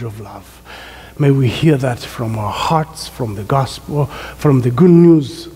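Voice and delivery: low-pitched, measured prayer voice; spoken prayer. Real, not acted. Preacher praying to the Lord before the sermon, low reverent prayer. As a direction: pray earnestly, letting the voice sink at phrase ends.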